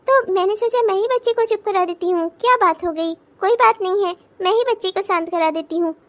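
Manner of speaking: ordinary talk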